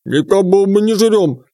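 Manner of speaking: indignant protest; offended creature voices. Acted. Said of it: "возмутились водяники".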